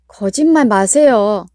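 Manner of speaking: loud and slow daughter's cry; shouted protest. Acted. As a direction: fling it back at him slowly and loudly. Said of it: "하고 외치며".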